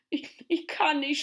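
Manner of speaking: high, stammered tearful protest; sobbing. Acted. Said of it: "schluchzte Tommy".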